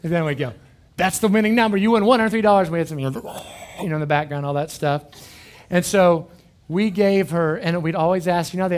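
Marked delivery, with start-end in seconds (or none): 1.0-2.7 louder, a bit higher, hammed-up win; mock DJ announcement. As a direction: announce the prize like a radio host.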